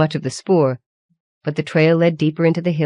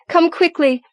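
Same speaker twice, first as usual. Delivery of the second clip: urgent call; 12 semitones higher than usual. A narrator's reading. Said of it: "she shouted".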